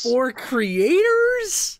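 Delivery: high, very slow disbelieving echo; incredulous. Real, not acted. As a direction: stretch it out in disbelief. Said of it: "incredulous echo".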